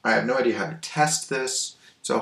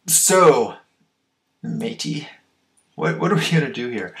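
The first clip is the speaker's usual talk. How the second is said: pirate voice; 4 semitones higher, 6 dB louder than usual. Pretend. Pirate talk on Pirate Day.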